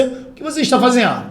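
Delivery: high-pitched question, "está" lifted then falling; direct question. Shown as a demonstration. Teacher demonstrates asking someone directly, high questioning tone. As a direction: ask it outright, pitched well up. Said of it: "Não tô perguntando diretamente a você".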